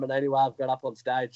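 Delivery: ordinary talk, nothing marked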